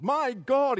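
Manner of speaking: anguished cry. Acted. Psalm cry read aloud.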